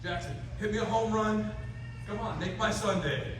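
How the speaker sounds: high, loud coach's rallying call; coach cheering on the batter. Retold or imitated. Coach calling to Jackson at the plate, loud and high-pitched. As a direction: fire up the kid from the dugout. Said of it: "he goes".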